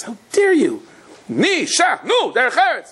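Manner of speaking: retold indignant rebuke, high and sharp; mock outraged scolding. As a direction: shush him like an offended crowd. Congregants scold the rude guest.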